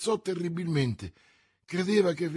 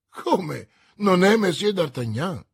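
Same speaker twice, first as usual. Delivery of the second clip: loud, higher disbelieving question; astonished exclamation; 5 semitones higher, 8 dB louder than usual. A narrator's reading. Commissioner learning he has the wrong man, astonished. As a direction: echo it back in loud astonishment. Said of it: "esclamò il commissario".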